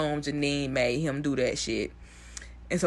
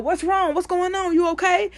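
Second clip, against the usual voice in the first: much higher, a bit louder, all concern; mock Barb, rushing up; 13 semitones higher, 3 dB louder than usual. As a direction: rush in like a worried veteran teacher. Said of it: "Barb hops up quick as hell".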